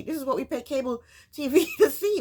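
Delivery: ordinary delivery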